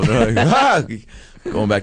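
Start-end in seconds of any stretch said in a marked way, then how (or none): 0.5-0.8 high, loud mock scream. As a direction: scream like a scared reality star. Snooki screaming in a bubble.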